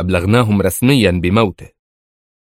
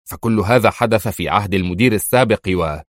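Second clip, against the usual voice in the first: ordinary delivery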